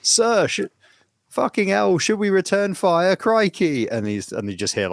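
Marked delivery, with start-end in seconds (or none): 0.0-3.9 high, loud, panicked crewman; hammed-up sailor under fire; frantic sailor. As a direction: shout up to the captain in alarm. Acting out frantic sailor in joke.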